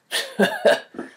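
airy high breathy laugh; bored laugh. Shown as a demonstration. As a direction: laugh like you are bored. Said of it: "be like when you're bored".

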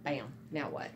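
normal talking voice

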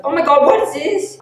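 sudden high, loud alarm; real panic as she spots the mistake; shrill-free startled yelp of alarm; shocked squeal. Real, not acted. Panicked about a missed chunk.

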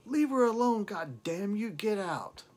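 high furious yell; angry shout. Retold or imitated. Sister yelling at the singer to leave. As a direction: scream at him to get out. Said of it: "her sister did shout".

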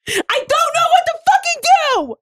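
high, slow exasperated shout; frustrated yell. Real, not acted. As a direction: blurt it out in desperation. Frustrated outburst when put on the spot.

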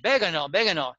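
"Vegano" stressed on the first syllable; learner's persistent slip, weak middle vowel in "Vegano"; mispronounced Spanish. Retold or imitated. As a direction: repeat "Vegano" as BEH-guh-no. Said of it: "I kept saying".

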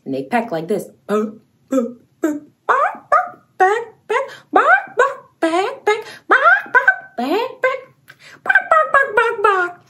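very high squeaky clucking; chicken clucks. Pretend. Kids' presenter playing chicken, high playful clucks. Chicken imitation while flapping arms.

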